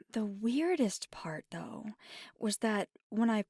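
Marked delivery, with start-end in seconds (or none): none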